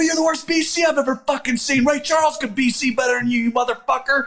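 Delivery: high, heated insult hurled back; out-cuss your commander, voice way up; retold furious comeback; going off on him. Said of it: "I'm going off on him".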